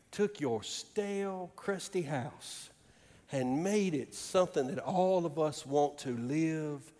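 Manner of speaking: usual speaking voice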